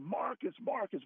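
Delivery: high-pitched mock call; retold urgent calling out to the roommate; yelling for roommate. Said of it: "I'm yelling".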